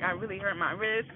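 ordinary talk

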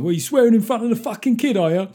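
hammed-up angry working-class drunk dad; a mock rant from the back of the room, loud and fast; drunk dad shouting. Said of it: "working-class drunk dad, he'd have gone".

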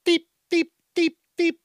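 squeaky toy beeps; high beeping. Retold or imitated. Beeping at a pet to confuse it, high. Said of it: "what sound that is".

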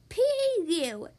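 pinched nose voice; holding nose. A narrator's reading. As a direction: say it with your nose held. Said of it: "holding her nose".

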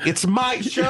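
high, incredulous protest with a laugh; mock indignation. Show owner answering the composer, indignant and high.

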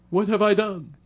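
high, incredulous "What have I done?" as self-reproach; exasperated rhetorical. Shown as a demonstration. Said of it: "could have been interpreted as you did something".

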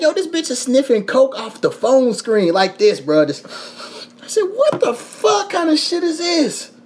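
high-pitched incredulous outburst; retold disbelief. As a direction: voice shooting up in appalled amazement. Retold shock at coke on phone.

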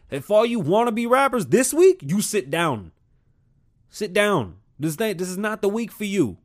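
loud, high ranting; heated rant. Real, not acted. Vlogger ranting at rappers to sit down. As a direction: tell them off with force.

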